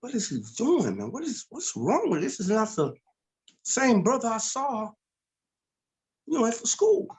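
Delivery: recalling his shock at the brother, voiced high and incredulous; disbelieving. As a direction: sound like you can't believe your eyes. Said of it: "And I'm like".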